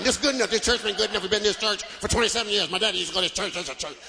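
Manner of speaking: put-on low stubborn old-timer voice; stubborn old member. Comedian voices an old church member refusing change, low and stubborn. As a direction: dig in like a set-in-his-ways deacon.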